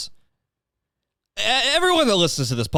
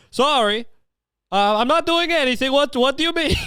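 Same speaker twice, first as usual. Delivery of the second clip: put-on high-pitched denial in a Borat accent; 11 semitones higher than usual. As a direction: play innocent in a hammed-up Borat accent. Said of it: "I talk like Borat".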